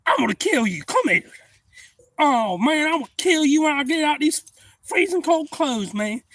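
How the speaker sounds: high, loud comic fury; acted angry threat. Freezing man threatening the friend who left him, acted angry threat. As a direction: holler the threat at your buddy.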